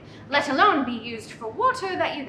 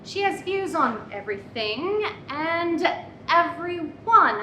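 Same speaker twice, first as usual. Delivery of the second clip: normal talking voice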